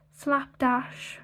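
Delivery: normal talking voice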